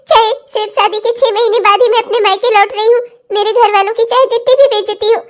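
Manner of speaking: halting, self-sacrificing tearful speech; crying. Acted. Daughter-in-law leaving six months after the wedding, crying. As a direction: sob through the farewell.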